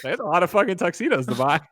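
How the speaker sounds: high, squeaky talk through real laughter; laughing hard. Real, not acted. Co-host cracking up over all the cloned tuxedos, laughing. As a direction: force the words out while cracking up.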